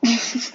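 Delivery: short stifled laugh; a brief, involuntary laugh. Real, not acted. Tutorial host amused by the model name "bed", laughing. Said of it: "I don't know why I'm laughing".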